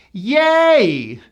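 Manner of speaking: "Yay" held high, gliding to Y; bright A sound. Shown as a demonstration. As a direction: stretch "Yay" with a clear Y at the end. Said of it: "bright A sound and a Y".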